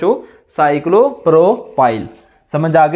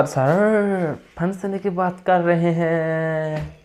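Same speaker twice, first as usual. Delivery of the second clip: soft, long-drawn-out mimicking of a student; mock student complaint; 8 dB quieter, much slower than usual. Student objects to teacher's talk of trapping, in drawn-out mock complaint. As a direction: drag the words out quietly, playing a pupil.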